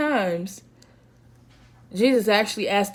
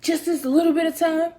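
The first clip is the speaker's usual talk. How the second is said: high, pleading rebuke; a disappointed friend, pitched way up; Jesus confronting friends; 8 semitones higher than usual. Retold or imitated. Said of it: "he confronted them again".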